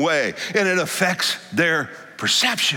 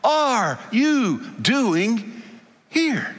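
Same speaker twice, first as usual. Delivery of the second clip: slow calling as God; 5 semitones higher, much slower than usual. Acted.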